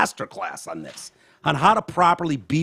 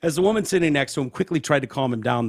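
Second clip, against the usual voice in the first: ordinary talk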